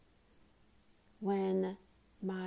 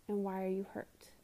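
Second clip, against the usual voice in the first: ordinary delivery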